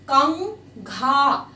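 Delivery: कंघा stretched slowly, tongue to palate on the ङ; slow nasal demo. Shown as a demonstration. Teacher to pupils, slowly modelling कंघा with the nasal. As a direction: draw out कंघा, humming the nasal. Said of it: "touch that your upper part of your mouth".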